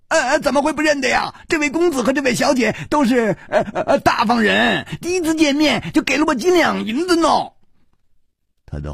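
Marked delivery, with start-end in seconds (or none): 0.1-7.3 put-on very high, fast child's voice; delighted little boy. A narrator's reading. Little baldy recognizing the generous couple, delighted and eager. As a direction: gush eagerly, stumbling on little hesitations. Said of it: "立刻眉开眼笑".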